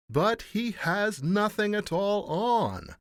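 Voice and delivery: loud, high, slow outcry; crying out. A narrator's reading. All the people at last crying out. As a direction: call it out drawn-out and high. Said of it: "cried out all the people".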